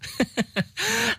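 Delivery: hohes, fremdartiges Kichern; dämonisches Kichern. Acted. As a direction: kicher hoch und hämisch. Dämon lacht amüsiert. (hohes Dämonenkichern)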